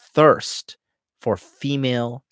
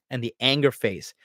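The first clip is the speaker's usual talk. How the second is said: ordinary delivery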